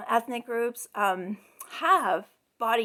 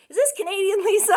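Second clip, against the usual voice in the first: mock eager caller; 7 semitones higher, 6 dB louder, faster than usual. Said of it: "she would be like".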